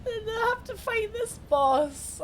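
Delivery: a playful fake cry, drawn out; mock sob. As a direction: wail it like a hammed-up sob. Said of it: "dreading the boss fight".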